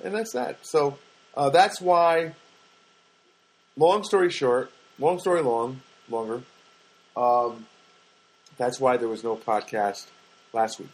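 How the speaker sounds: ordinary talk, nothing marked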